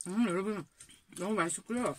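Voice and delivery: chewing while talking; speaking between bites; mouth full of food. Real, not acted. Seen 0: woman bites pasta at -4, lips pressed chewing while speaking.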